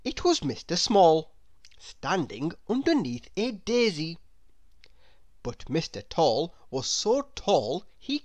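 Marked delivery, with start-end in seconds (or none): none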